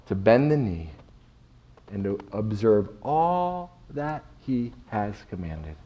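ordinary talk, nothing marked

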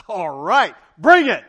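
loud, rising dare; bring-it challenge, pumped; mock bravado. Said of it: "I'm like".

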